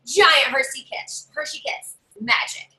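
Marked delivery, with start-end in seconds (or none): none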